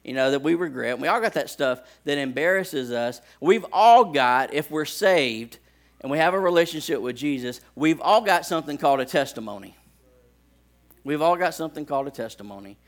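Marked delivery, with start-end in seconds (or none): 3.4-12.8 preaching. Acted. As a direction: drive the point home to the congregation. Preaching point.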